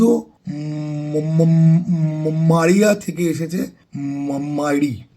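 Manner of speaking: stuck on the M, sound held and repeated before Maria and মাইরি; stuttering on M. Real, not acted. Explaining a Portuguese word origin to viewers, stuttering on M. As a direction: stammer into each M word, dragging out the M. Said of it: "আমার ম বলতে গেলে পর তোতলামি হয়".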